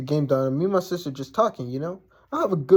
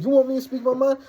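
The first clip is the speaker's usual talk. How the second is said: high-pitched, rising challenge; challenging comeback; 9 semitones higher than usual. Retold or imitated. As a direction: retell squaring up to him. Retold comeback in a confrontation.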